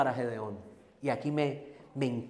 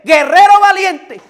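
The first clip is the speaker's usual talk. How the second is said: huge high shout, slow and much louder; impassioned shout; 19 dB louder, slower than usual. Real, not acted. Preacher proclaiming God's words to fearful Gideon, impassioned shout. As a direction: blast the title with real fervor. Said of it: "Perdóneme, pero me emocioné".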